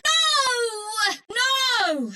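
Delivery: long, high wailed shout; cry of dismay. Acted. Cursing as things go wrong, long high wail. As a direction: draw it out high, crying in protest.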